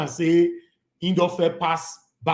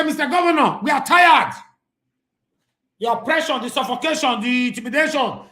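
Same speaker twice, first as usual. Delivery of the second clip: high, loud shouted complaint; mock protest shout; 7 semitones higher, 5 dB louder than usual. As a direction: shout it like a fed-up party member. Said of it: "I dey add my own join am now".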